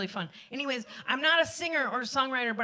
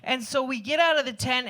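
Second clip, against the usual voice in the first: ordinary talk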